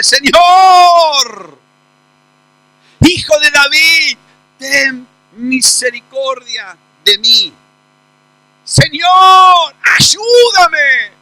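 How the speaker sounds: loud pleading cry to the Lord; desperate mother shouting after Jesus. Acted. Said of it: "comienza a gritar".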